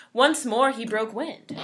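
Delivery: ordinary delivery, nothing marked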